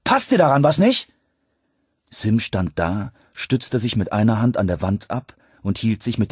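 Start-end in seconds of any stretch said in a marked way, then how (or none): 0.0-1.1 loud, high, angry challenge; grim. A narrator's reading. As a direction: challenge him, spoiling for a fight. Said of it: "sagte ich grimmig". (grimmig und laut herausfordernd)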